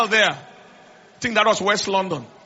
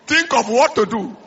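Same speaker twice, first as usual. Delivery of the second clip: ordinary talk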